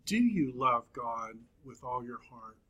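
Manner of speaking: ordinary talk, nothing marked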